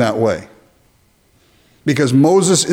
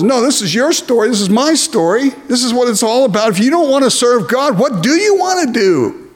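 urgent challenge; 8 semitones higher than usual. Real, not acted. Preacher presses the congregation hard.

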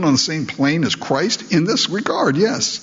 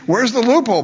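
a high, louder mock plea for a way out; mock loophole hunt; 8 semitones higher, 4 dB louder, faster than usual. Preacher imitating wives asking for a loophole, high and loud. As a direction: pitch it up like you're hunting for an escape. Voicing women seeking a loophole.

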